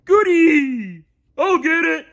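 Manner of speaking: high and loud Patrick squeal; a thrilled starfish dashing off; excited cry. A narrator's reading. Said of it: "Patrick cried".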